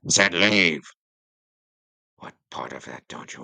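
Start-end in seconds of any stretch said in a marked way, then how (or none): 0.0-0.8 loud, furious command; shouting. Acted. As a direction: bark the order to get out. King orders everyone out.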